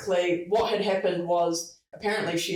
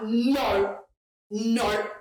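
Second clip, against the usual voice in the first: imitating a stubborn client, raised pitch; stubborn, cocky; 4 semitones higher than usual. Client refusing to sell as is, stubborn. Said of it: "scorpion vibes, like, really stubborn, cocky".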